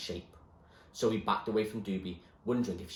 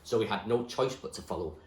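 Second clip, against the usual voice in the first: usual speaking voice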